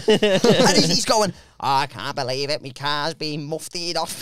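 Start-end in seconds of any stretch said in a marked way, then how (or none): 1.6-4.2 low, soft, slowing lament; Chris. Retold or imitated. Friend convinced his car is stolen, low slow moan. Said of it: "You can tell he's from the Swan".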